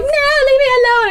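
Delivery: high-pitched panicky refusal; hammed-up fleeing victim; mock frightened cry. Imitating a woman running from her pursuer, mock frightened. Acted chase, woman shouting back.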